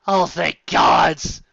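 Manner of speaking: slow, drawn-out thank gods; overblown relief. Real, not acted. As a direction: sigh out mock relief. Exaggerated relief.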